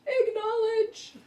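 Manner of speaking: very high, loud, slow drawn-out shout; mother shouting at kids who ignore her, mock yell; mock bellow. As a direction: bellow it up the stairs, hammed-up. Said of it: "we always have a joke in our family about".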